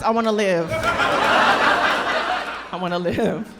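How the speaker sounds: desperate, laughing plea, the last word drawn out very slowly. Pretend. A virgin begs the crowd to spare her, laughing. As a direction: beg to live, cracking up. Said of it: "I'd be like".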